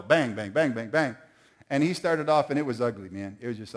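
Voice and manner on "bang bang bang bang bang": bang out each beat with the voice; imitated drum bangs. Father imitates his kid banging the new drums.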